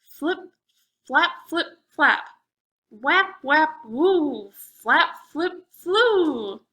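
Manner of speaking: loud, high flapping noises; flapping wing sounds. A narrator's reading. Bat swooping from the cave, loud flapping sounds. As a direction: flap the words like wings beating. Bat wings heard approaching.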